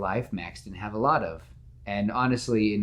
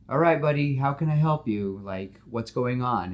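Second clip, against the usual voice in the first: high, louder friendly hero voice; cheerful, chatty superhero; Spider-Man voice; 4 semitones higher, 3 dB louder than usual. Acted.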